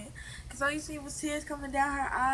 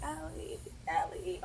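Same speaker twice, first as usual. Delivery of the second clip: soft retold calling of a name; calm, not crying; 3 semitones higher, 7 dB quieter, slower than usual. Friend retelling the calmer ride with another girl, soft calling. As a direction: say the name softly, without alarm. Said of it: "I wasn't crying, but I was like".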